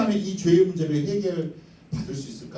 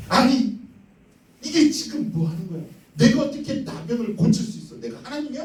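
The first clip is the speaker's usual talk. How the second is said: panicked king; 10 semitones higher than usual. Acted. The king of Israel reading the letter, high panicked outcry. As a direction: cry out in disbelief like a cornered king.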